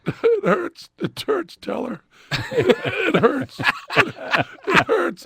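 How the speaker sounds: high, whimpering pleas of pain; crying in pain. Retold or imitated. To the magician, pleading in pain while crying. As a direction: beg through the hurt, near tears. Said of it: "And I was crying".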